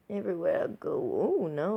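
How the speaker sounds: ordinary delivery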